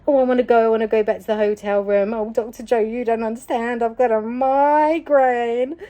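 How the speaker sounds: high mock whine, last word dragged out; whiny inner voice. Protesting to Doctor Joe inside her head, whining. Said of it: "I had another voice as I was having".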